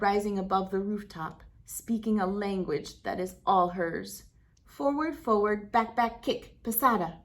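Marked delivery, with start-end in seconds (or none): none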